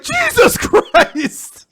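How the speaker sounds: loud, high, drawn-out shock; hammed-up shriek of disbelief; mock horror. Parents finding what looks like murder, mock horror. Parents see the torn toy.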